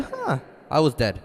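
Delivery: normal talking voice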